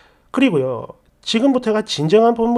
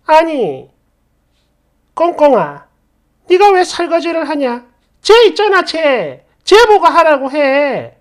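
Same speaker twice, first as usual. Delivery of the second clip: raised, higher, indignant scolding; yelling mother-in-law; 5 semitones higher, 5 dB louder than usual. Acted. Mother-in-law stopping her son washing dishes, yelling. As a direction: build to a drawn-out, bossy yell. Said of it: "버럭버럭 소리를 질렀고".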